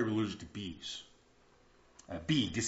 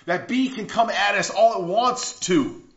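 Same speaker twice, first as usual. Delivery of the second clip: louder, higher, proclaiming the victory; rising preaching; 9 semitones higher, 9 dB louder than usual. Acted. Preacher proclaiming to the congregation, loud and triumphant. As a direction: preach it out with conviction.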